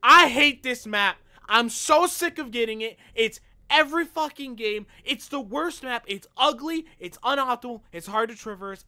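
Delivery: high, loud listing of gripes; angry rant. Real, not acted. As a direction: spit out every complaint. Ranting about the hated map.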